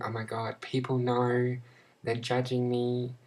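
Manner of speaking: ordinary talk, nothing marked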